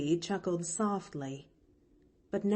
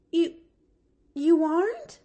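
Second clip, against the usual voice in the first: high, louder stammer of dismay; 10 semitones higher, 7 dB louder than usual. Acted. Little girl dismayed at the mistake, stammering high. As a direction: stumble over it, shocked. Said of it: "stammered the little girl in plain dismay".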